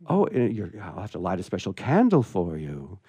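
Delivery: plain reading voice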